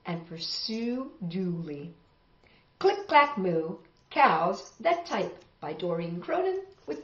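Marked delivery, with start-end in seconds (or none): none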